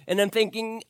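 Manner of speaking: usual speaking voice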